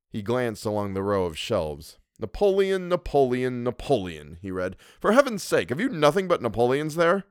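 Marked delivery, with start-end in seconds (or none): 2.2-7.2 starts high, drops, then bursts out much higher; exasperated Louis. Acted. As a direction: read titles in growing disbelief. Louis reading titles, exasperated.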